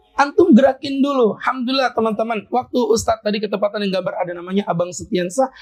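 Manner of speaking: ordinary delivery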